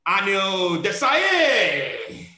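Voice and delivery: slow build to a loud, stretched name; drawn-out introduction, soaring on the surname; guest announcement. Acted. Host announces his first guest.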